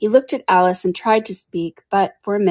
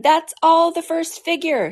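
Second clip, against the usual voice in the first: ordinary delivery, nothing marked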